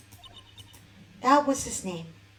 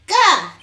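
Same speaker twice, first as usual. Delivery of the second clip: startled yelp; 6 semitones higher, 12 dB louder than usual. Acted. Startled by character's return.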